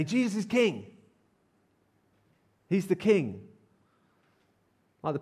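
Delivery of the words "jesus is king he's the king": higher-pitched, mild mock shout of acclaim; understated cry of homage; crowd's acclamation. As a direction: proclaim it like a welcoming crowd. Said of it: "Instead of shouting".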